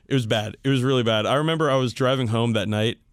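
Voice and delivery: ordinary talk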